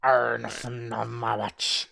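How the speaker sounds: put-on pirate accent. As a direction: play it like a pirate on watch. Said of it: "Speak like a pirate".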